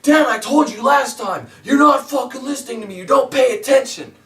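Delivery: imitating an enraged troll, high and loud; raging commenter. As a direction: play the furious viewer screaming at Dan. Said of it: "and he'd be like".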